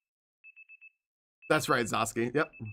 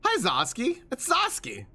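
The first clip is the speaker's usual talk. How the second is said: high cheery greeting; 8 semitones higher than usual. Real, not acted. Greeting viewer by name.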